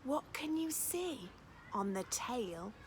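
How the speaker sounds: plain reading voice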